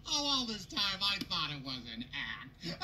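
ordinary delivery

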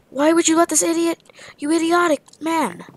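high, loud exasperated yell at a teammate; indignant yell. Real, not acted. Gamer yelling at a careless teammate, exasperated. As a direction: blast the idiot who let it happen.

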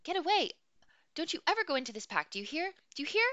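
high, loud furious yelling; furious scolding. Acted. Woman warning the boy off her pack, furious yelling. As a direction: tear into him, furious.

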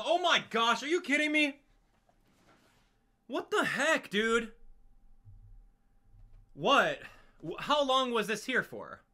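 real high, loud shocked yelling; screaming. Real, not acted. Player startled by what was sitting there, screaming. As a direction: scream it out in disbelief. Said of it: "I'm screaming at the top of my lungs".

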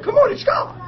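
high, fervent preaching to the doctor; high excited mock sermon. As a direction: proclaim it like a street preacher. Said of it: "there I am preaching to the doctor".

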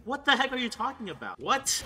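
indignant. Real, not acted. Gamer answers a player calling his trade a scam, indignant. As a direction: react in disbelief to the accusation.

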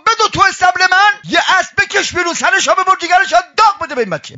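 high-pitched, rapid-fire orders; urgent retold command. Master orders servant to help orphan, urgent high command.

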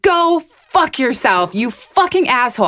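high, slow mock yell at the men; retold angry yell. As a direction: spit it out slowly, furious but retold. Retold yelling at catcallers.

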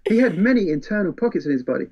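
lightly voiced cheerful sinister vampire; Caldania. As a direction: rattle off horrors like helpful advice. Caldania's disturbing advice.

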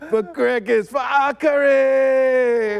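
high, drawn-out war shout; rally the troops with a slow cry; battle cry. Acted. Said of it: "I am Draka".

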